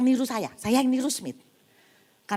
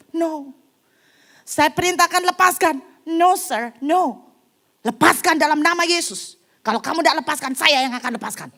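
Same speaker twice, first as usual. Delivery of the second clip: loud, higher insistent orders; forceful command; 6 semitones higher, 8 dB louder than usual. Retold or imitated. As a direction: overrule them with authority. Smith commanding the helpers. (perintah keras dan tegas)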